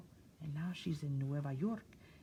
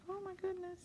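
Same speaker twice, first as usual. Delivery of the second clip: surprised; 6 dB quieter than usual. Pretend. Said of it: "She looks a little bit like".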